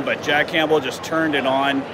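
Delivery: ordinary delivery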